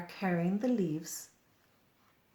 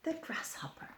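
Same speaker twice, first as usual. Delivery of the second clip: whisper; 11 semitones higher, 7 dB quieter than usual. Acted. Announcing next creature.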